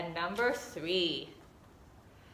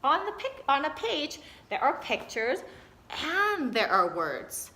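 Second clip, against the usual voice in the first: put-on excited child's voice, higher; child discovering; 5 semitones higher than usual. Teacher voicing a child who notices words, eager. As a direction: sound like a little one noticing something new. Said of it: "we want the little ones to start noticing".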